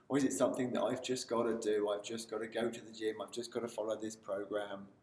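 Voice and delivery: low, soft, dreary monotone; unexcited. Shown as a demonstration. Coach showing a voice that dreads the gym, unexcited. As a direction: drone it like a tiresome chore. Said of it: "you're not sounding excited".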